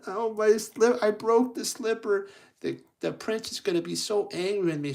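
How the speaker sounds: high, whiny pretend sobbing; mock crying. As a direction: wail it like a hammed-up crybaby. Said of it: "as he's crying".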